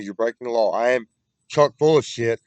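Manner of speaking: ordinary talk, nothing marked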